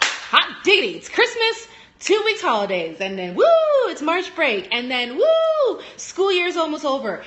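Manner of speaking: high, loud holiday whoops; gleeful cheering. Retold or imitated. Teacher cheering for each coming school break, gleefully excited. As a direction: re-enact your own giddy celebration, whooping on each woo. Acting out own holiday glee.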